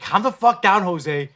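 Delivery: loud, urgent telling himself to calm down; frantic self-pep talk. Retold or imitated. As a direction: shout yourself into calming down. Talking himself down, panicking.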